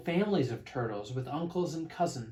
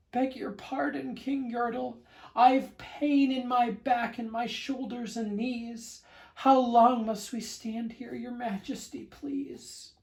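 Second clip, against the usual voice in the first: much higher, timid pleading voice; meek little turtle; 10 semitones higher than usual. Acted. Mac at the bottom begging the king for relief, high timid plea. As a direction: plead small and polite, fading to nothing. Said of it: "this plain little turtle looked up and said".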